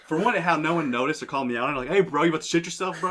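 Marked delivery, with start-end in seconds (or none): 1.9-3.1 higher-pitched mock call-out; imitated heckler calling him out. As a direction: put on a bystander's nosy challenge. Voices someone calling him out.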